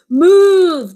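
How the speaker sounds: loud high shout of knights. Acted. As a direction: yell a warning to the boy. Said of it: "they shouted".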